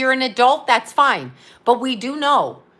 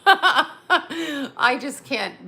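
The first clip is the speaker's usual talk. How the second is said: laughing; 4 semitones higher, much slower than usual. Real, not acted. Giggling fit over movie.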